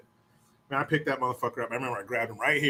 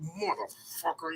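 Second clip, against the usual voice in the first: Ralphie style curse; 3 semitones higher, 11 dB quieter, slower than usual. Retold or imitated. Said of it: "I was like Ralphie in Christmas Story".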